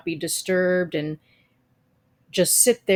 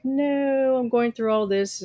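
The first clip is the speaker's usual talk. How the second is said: mock dismay; 6 semitones higher than usual. Vlogger replaying her worry about the recording, mock dismay. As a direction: retell a moment of worry, pitched up then settling.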